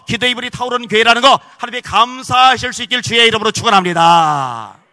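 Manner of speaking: loud, high, impassioned sermon climax; fiery preaching. Acted. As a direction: preach it out to the whole hall. Preaching at full force.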